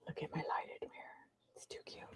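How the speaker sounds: very soft whispered gushing; hushed, barely voiced whisper. Real, not acted. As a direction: breathe it as a delighted secret. Showing off her lighted mirror.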